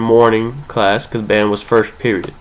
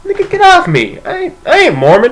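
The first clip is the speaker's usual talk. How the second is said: put-on indignant teen. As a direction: squeal it out, offended and laughing. Emilio rejects band director.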